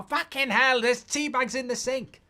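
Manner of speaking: hammed-up fury at the sink; imitating an angry coworker. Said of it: "He really used to get angry".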